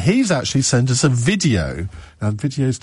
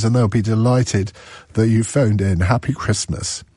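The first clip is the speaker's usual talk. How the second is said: usual speaking voice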